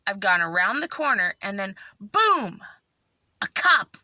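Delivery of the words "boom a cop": ordinary delivery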